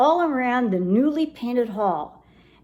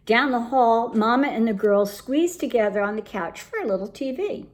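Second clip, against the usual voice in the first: plain reading voice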